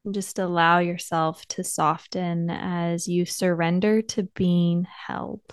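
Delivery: soft, unhurried meditation voice with long pauses; guided meditation. Acted. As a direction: gently guide listeners inward, leaving space between phrases.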